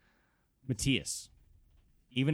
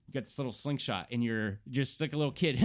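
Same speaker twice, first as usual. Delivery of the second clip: normal talking voice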